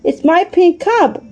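high, drawn-out delighted exclamation; very excited. A narrator's reading. Benny spotting his own pink cup in the cave, very excited. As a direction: exclaim it high, stretching the words. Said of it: "made him more excited than ever".

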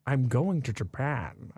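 slow Scottish-tinged Sean Connery voice, "Japan" with a "sh" sound; running joke in a lazy Sean Connery voice; Sean Connery impression. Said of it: "we go".

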